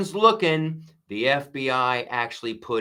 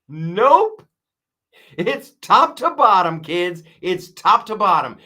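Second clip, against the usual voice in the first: much higher, louder emphatic nope; loud rebuttal; 6 semitones higher, 7 dB louder than usual. Real, not acted. Host rejecting the few bad apples claim, loud emphatic shout.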